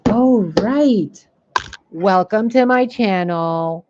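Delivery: mocking fake psychic. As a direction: ham up a fake psychic greeting. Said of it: "What's keeping you from being".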